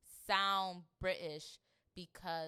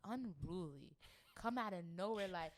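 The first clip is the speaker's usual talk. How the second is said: usual speaking voice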